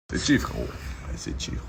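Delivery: breathy unvoiced whisper. Real, not acted. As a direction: whisper it like a secret. Repeats tagline under his breath.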